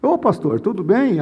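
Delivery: suddenly deferential phone tone; changed polite voice. Acted. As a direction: switch to your best voice for the pastor. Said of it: "você quer mudar até a voz".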